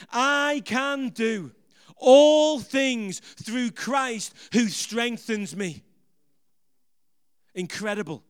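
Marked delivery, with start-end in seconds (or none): 0.1-5.8 slow chant of the verse, swelling louder on all things; louder call-and-response. Shown as a demonstration. Preacher urging the congregation to repeat it, louder slow chant. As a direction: lead them again, pushing the volume up. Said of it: "Come on, say it again with me".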